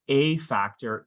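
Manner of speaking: "a" said as a full, stressed "ay". Real, not acted. Lawyer stating the legal test to employers, stress on A. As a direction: lean on "a", pronouncing it "ay", before "factor". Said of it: "you heard me emphasize the word a".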